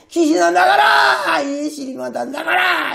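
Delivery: long drawn-out cry casting out a demon; imitating a sufferer's desperate exorcism cry; imitated wailing command. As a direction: turn the pained wail into a stretched command. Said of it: "그 악 쓰던 소리를".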